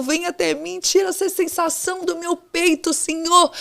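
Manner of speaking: crying out to God, frantic and high; desperate pleading prayer. Retold or imitated. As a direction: beg for rescue as if you can't breathe. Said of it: "e sem conseguir respirar".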